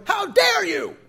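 high, loud, indignant cry; outraged shout. Acted. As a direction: hurl it at God, wounded and furious. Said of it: "That's Jonah".

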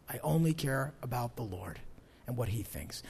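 hushed, breathy whisper of devotion; soft private whisper; whispered vow. Retold or imitated. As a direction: drop to a whisper, as if confiding to God. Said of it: "if you right off the bat say".

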